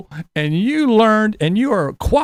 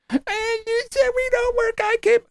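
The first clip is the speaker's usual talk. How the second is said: much higher, thin crying mock voice; whiny liberal mimic; 13 semitones higher, 3 dB quieter than usual. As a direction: squeak it like a whiny opponent. Mocking liberal reply.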